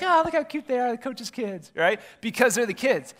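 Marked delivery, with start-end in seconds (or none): 0.0-1.7 cooing spectator. Retold or imitated. Spectators cooing at the coach's kids, high mock gush. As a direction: coo over the kids like a doting fan. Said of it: "People be like".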